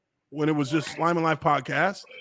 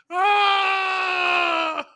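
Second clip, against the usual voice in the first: laughing howl; 13 semitones higher than usual. Real, not acted. Host recovering from co-host's joke, howling with laughter. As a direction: wail it out at the end of a laughing fit. Howls after long laughing fit.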